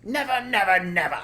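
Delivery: high, loud repeated never; fierce loud high refusal; cried. Acted. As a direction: cry it out stubbornly. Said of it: "cried Bogus".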